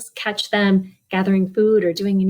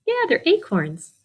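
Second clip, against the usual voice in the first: plain reading voice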